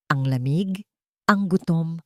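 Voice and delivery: usual speaking voice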